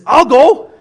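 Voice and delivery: shouted I'll go, bursting to answer; eager I'll go. Retold or imitated. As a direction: leap at the call. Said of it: "he says".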